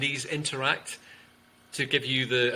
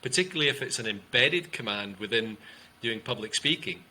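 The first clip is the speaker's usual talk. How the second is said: ordinary talk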